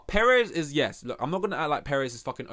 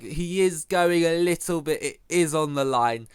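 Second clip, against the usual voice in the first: slow, louder commentator voice with a changed timbre; imitating commentator; 3 semitones higher, 6 dB louder, slower than usual. Mocking the race pundit who answered on air, in a slow, louder commentator voice. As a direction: drag each word out like a TV pundit. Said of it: "And the guy's like".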